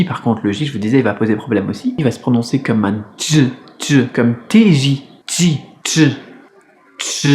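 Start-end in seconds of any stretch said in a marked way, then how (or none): 3.8-7.3 "tj" with an unaspirated, sticky T; T non aspiré. Shown as a demonstration. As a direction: say "tj" as a T with no puff of air. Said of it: "C'est un T vraiment pas du tout expiré, très collant". (« tj » avec un T collant, sans souffle)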